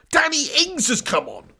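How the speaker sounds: ordinary talk, nothing marked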